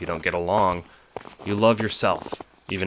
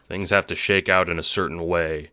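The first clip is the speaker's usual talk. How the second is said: usual speaking voice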